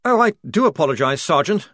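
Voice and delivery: quick, flustered apology; hasty apology. Acted. Lieutenant apologises to the sergeant, fast. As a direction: rush the apology out.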